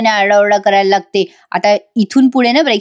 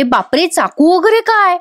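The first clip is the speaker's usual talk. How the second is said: mock alarm; 7 semitones higher than usual. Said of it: "Nandini पण घाबरते".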